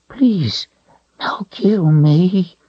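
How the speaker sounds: high, slow whimpering plea; creature whimper. A narrator's reading. Pinned creature whimpering to Richard for mercy. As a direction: whimper like a beaten thing. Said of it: "it repeated in a whimper".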